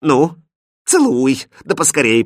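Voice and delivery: high, imperious command; peremptory princess in a hurry; impatient demand. A narrator's reading. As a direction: order it impatiently. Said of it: "потребовала принцесса".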